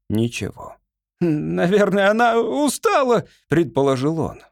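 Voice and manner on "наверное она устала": high, tentative servant's voice; timid supposition. A narrator's reading. Servant guesses why the weapon fails, tentative. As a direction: suggest it meekly, pitched up high.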